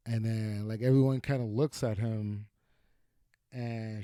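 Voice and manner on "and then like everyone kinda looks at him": ordinary delivery, nothing marked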